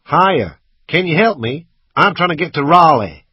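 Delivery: loud, high American call for help; put-on American accent. A narrator's reading. Motorist asks driver for directions, American accent. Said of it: "My American accent was quite good".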